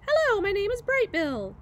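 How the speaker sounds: high, bright little greeting; proud little gosling. Acted. Gosling greets anyone who will listen, high chirpy voice. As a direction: chirp it like a small bird showing off.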